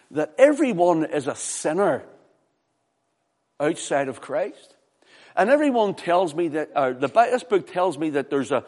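loud, high, insistent pulpit cry; full-force preaching. Real, not acted. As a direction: drive each point home to the congregation.

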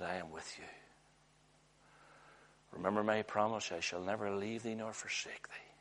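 hushed voice sinking to a whisper; the Lord whispering comfort, soft and hushed. Acted. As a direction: lay it down gently, almost breathed. The Lord's voice quoted softly.

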